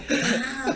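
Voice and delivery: high yelped scream; mock scream. Buddy hit by a jumping mullet, high startled yelp. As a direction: imitate his startled shriek.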